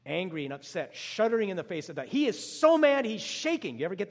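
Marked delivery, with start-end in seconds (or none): 2.1-3.8 much higher and louder, burst of preaching fire; preacher's outburst of indignation; fiery emphasis. Real, not acted. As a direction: erupt on the word mad. Describing Jesus' anger.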